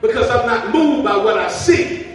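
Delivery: loud ringing declaration with a laugh; preaching at full force. Acted. Preacher's closing cry to the church, loud and joyful. Climax of sermon, shouted.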